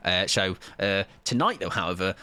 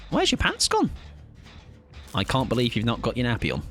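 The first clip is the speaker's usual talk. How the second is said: put-on baby talk; 13 semitones higher than usual. Teasing a pantsless teammate in squeaky baby talk. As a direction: coo at him like a toddler without a nappy.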